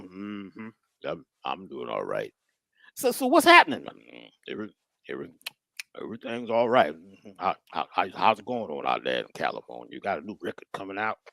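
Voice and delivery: put-on low, soft, slurred old man's voice; old uncle. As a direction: mumble low like an uncle on brown liquor. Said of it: "I call him and I say".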